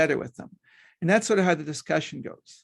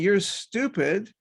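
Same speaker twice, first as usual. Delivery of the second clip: slow scolding; 4 semitones higher, much slower than usual. Shown as a demonstration. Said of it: "Rather than saying".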